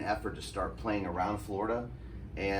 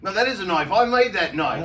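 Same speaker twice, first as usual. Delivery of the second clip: loud, high mock Aussie accent; indignant Australian; 8 semitones higher, 9 dB louder than usual. Australian knife maker defending his knife, indignant Australian accent. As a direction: protest in a put-on Australian accent. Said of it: "I meet this Australian guy".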